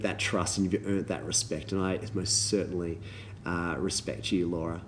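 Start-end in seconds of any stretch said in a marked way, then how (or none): none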